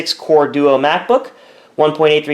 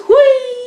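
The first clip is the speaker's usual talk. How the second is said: high silly whee; goofy squeal; 5 dB louder than usual. Acted. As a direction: squeal it out playfully. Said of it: "I'm just trying to be humorous".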